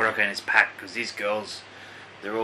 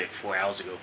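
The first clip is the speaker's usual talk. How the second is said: normal talking voice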